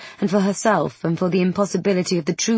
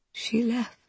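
soft and slightly higher; hushed grief, rising; 5 semitones higher, 7 dB quieter than usual. Acted. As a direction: repeat it lost in grief. Grieving Queen repeats herself.

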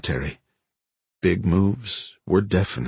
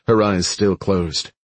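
ordinary delivery

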